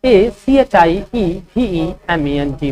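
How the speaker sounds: slow letter-by-letter dictation; slow spelling dictation; spelling out slowly. Shown as a demonstration. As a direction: spell each letter clearly for students. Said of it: "achievement का spelling क्या होगा".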